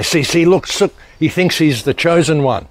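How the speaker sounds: mock an accuser excitedly pointing it out; mocking accusation. Mimics his critic's gloating accusation.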